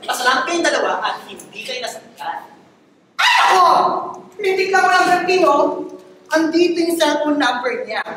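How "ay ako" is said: sudden high yelp; surprised exclamation. Real, not acted. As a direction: blurt it out in surprise.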